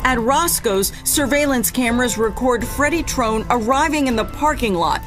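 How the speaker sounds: normal talking voice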